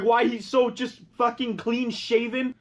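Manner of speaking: usual speaking voice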